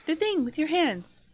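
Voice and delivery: high, loud monkey exclaiming; small creature correcting someone loudly; talking monkey. Acted. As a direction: pipe up as a fussy little monkey. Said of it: "The monkey".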